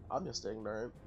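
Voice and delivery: ordinary talk, nothing marked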